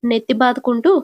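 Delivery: ordinary delivery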